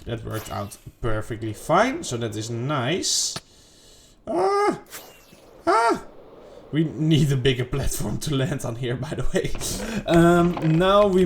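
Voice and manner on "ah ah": short, very high-pitched "ah"; quick squeaky exclamations, far above normal pitch; high yelp. Real, not acted. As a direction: let out two separate little high yelps. Short yelps during gameplay.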